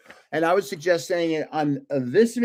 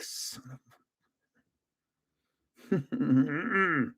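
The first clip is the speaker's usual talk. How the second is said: muttered curse; 8 dB quieter, much slower than usual. Retold or imitated. Cursing the technician over the ticket.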